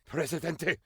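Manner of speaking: ordinary talk, nothing marked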